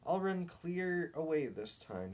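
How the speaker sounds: a touch of breathless running; mild panting. A narrator's reading. Dog tells himself he'll run away, a little breathless. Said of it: "he panted".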